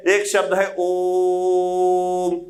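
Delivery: prolonged pluta vowel; drawn-out Om. Shown as a demonstration. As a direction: stretch the Om chant-like, very slow. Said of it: "उच्चारण काल बढ़ाना होगा".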